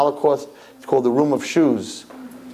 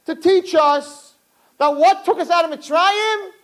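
preaching build; 12 semitones higher than usual. Acted. Rabbi preaching to the crowd, building up. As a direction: build the sermon to a ringing peak.

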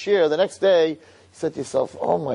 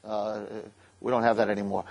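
sheepish mumble; 7 semitones lower, 7 dB quieter than usual. Acted. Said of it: "And then he's like".

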